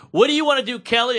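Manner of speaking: high, loud pep-up question; hyped instructor. Retold or imitated. Instructor cheering on Kelly in class. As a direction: hype a rider like a spin coach. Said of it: "the instructor was like".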